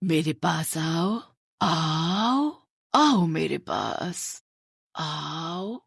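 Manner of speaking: slow, drawn-out ghost voice luring him close; ghostly beckoning. Acted. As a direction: call him in slowly like a spirit in the night. Ghost voice calling Ravi. (धीमी खिंची हुई भुतहा पुकार)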